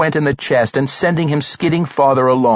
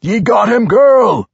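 loud, high triumphant shout; crying out; 11 semitones higher, 6 dB louder than usual. A narrator's reading. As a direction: holler it to the girl in triumph. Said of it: "Brunner cried".

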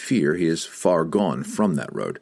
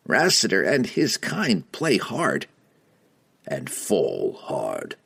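chilling cold voice; slower than usual. Acted. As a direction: let it drop cold and slow. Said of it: "The chill of Entreri's tone".

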